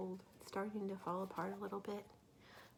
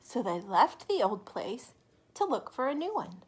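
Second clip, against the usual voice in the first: bright, loud read-aloud voice; storybook reading; 2 semitones higher, 9 dB louder than usual. A narrator's reading. Teacher reading a picture book to children, lively and loud. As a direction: read it big for the little ones.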